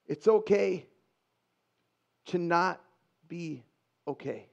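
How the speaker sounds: "It's okay", then long pauses before "to not", "be", "okay". Real, not acted. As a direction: let "be okay" drop very soft after pauses. Key message, said word by word.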